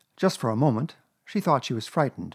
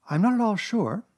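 high, musing mother voice; thoughtful Kanga; 11 semitones higher, 3 dB louder than usual. A narrator's reading. Kanga considering a cold bath for Roo, thoughtful. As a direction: muse aloud in a raised voice. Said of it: "said Kanga in a thoughtful voice".

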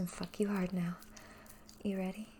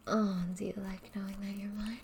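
normal talking voice

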